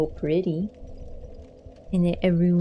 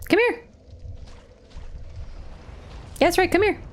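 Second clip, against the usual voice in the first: coaxing call; 10 semitones higher, faster than usual. Real, not acted. Luring the game boss over.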